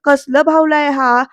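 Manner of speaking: high drawn-out mock yell, then eases; acted shriek. Shobha orders the strange doll put down, acted high shriek. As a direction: squeal in alarm, a storyteller's imitation. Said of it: "शोभा ओरडते म्हणते".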